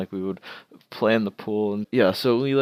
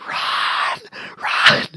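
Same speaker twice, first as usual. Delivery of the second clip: retold yelling; 5 dB louder than usual. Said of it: "the other one just started screaming".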